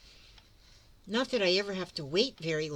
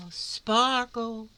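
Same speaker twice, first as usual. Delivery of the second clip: higher, louder, delighted; excited; 5 semitones higher, 5 dB louder than usual. Shown as a demonstration. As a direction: make the word sparkle. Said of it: "do you see how it sparkles?".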